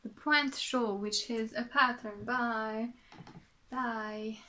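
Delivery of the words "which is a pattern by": ordinary talk, nothing marked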